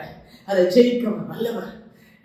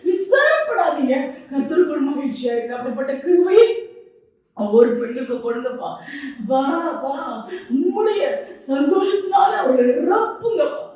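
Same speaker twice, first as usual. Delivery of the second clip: impassioned preaching; 8 semitones higher than usual. Acted. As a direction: proclaim God's promise with full force. Prayer rises to full force. (உணர்ச்சிமிக்க பிரசங்கம்)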